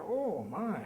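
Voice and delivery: put-on wide-eyed kid reaction; comic dismay; mock shocked.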